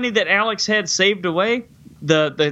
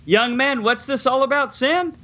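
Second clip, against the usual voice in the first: higher, half-hammed voice of a questioning doctor; mock stern doctor; 6 semitones higher than usual. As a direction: play the doctor quizzing the boy, lightly. Doctor questions Alex in retelling.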